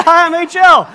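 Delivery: high, very loud hello; hammed-up, shouted self-introduction; over-eager greeting. Preacher greeting the angry husband for laughs, over-eager shout.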